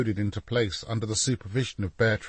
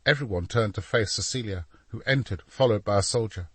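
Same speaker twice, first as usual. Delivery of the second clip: ordinary delivery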